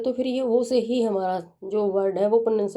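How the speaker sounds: ordinary talk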